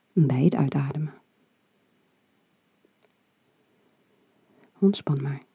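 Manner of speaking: soft breathy whisper; right in your ear. Acted. Whispers the exhale cue.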